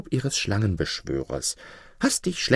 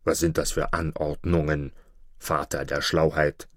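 much lower wolf voice, wary; gruff wolf; 8 semitones lower than usual. Acted. Wolf in the pit questioning the fox, low and wary. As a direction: ask it low like a suspicious wolf.

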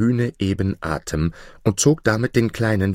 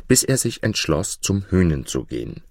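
ordinary delivery, nothing marked

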